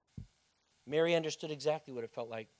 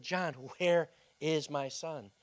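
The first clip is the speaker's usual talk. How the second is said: slow, drawn-out desperate plea; a mother begging to find her son; slow pleading; 4 dB louder, slower than usual. Acted. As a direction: beg him slowly for an answer.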